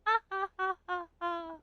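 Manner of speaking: high, acted ha-ha laugh; Fortuna's laugh. Fortune-teller answering her friend's joke, high laugh. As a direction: laugh high and light, fading.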